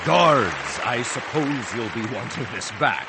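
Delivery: loud showy call, then smug; grand magician. A narrator's reading. Magician calling the guards, grand. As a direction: hand it over with flair.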